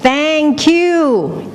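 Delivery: high, long calling out; cliff-top shout. Acted. Someone on a cliff shouting thanks, high call. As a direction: sing it out across the valley. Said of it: "you stand on top of a cliff".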